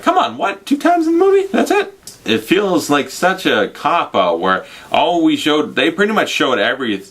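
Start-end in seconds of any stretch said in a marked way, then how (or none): 0.0-1.9 high-pitched, exasperated disbelief; incredulous moviegoer. Acted. Imagined viewer complaining about a two-scene cameo, incredulous. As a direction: squeak out a let-down viewer's protest. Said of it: "You'd be like".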